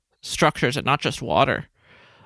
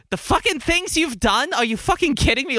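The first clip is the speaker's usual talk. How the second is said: furious yell; 10 semitones higher than usual. Acted. Player voices enraged character beating captive.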